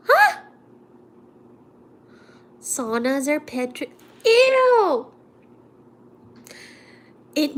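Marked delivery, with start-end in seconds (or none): 0.1-0.4 very high squeak of disbelief; surprised huh. Real, not acted. Vlogger reacting to chat with a shocked huh. 4.2-5.1 high, loud grossed-out ew; disgusted ew. Real, not acted. Vlogger grossed out by the sauna germs, shrill ew.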